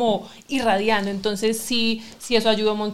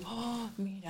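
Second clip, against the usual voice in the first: awed hush; 19 dB quieter, slower than usual. Retold or imitated. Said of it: "y yo".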